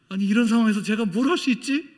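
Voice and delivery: high, loud baffled question; loud perplexed self-question; bewildered question. Retold or imitated. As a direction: ask helplessly in disbelief. Own bewildered thought.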